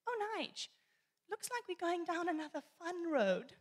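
high, bright-ish mock-cheery mom voice; sweetly hint to Dad it's a bad road; mom trying to be discreet. Mom hinting to dad about the road, mock cheery. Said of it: "trying to be discreet".

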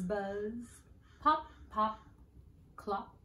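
ordinary delivery

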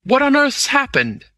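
high-pitched, urgent demand; alarmed cry. A narrator's reading. Lord Amersteth over the fallen man, alarmed cry. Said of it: "he cried".

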